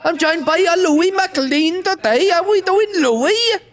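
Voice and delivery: high, bubbly, slow TV host voice; enthusiastic Welsh presenter. Retold or imitated. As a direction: gush like a chirpy presenter. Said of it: "that really enthusiastic Welsh bird".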